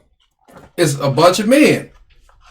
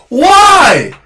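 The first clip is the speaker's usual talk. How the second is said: high, drawn-out exasperated cry; pleading, high-pitched protest; 9 semitones higher, 4 dB louder than usual. Retold or imitated. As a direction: wail the question in disbelief. Acted exasperated reply to partner.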